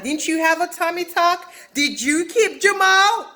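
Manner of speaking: high, challenging comeback, slowing at the end; mock confrontation. Housewife hitting back at her accuser, high mock challenge. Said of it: "kicks her with a one-two punch".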